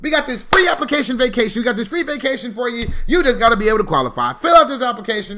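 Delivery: high, loud sales spiel; vacation promoter pitch. Retold or imitated. Imitating promoters offering a free vacation, hyped-up sales voice.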